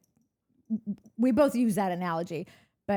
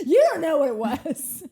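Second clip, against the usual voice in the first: louder, laughing protest; indignant laughing retort; 6 semitones higher, 8 dB louder than usual. Retold or imitated. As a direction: fire back with mock outrage. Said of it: "I'd be like".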